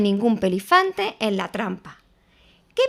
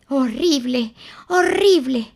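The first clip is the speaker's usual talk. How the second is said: panting; 4 semitones higher than usual. A narrator's reading. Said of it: "jadeando tan fuerte que casi no podía hablar".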